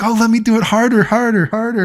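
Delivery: high, quick girly mock voice; imitating an eager girl. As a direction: bounce it out like an excited girl. Imitating the girl punching him.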